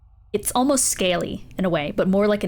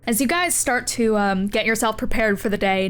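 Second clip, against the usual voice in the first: ordinary talk, nothing marked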